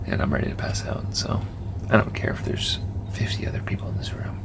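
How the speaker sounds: sleepy near-whisper, voice fading out; exhausted. Real, not acted. Exhausted vlogger signing off before sleep, drowsy whisper. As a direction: whisper it as if about to pass out. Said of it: "I'm exhausted".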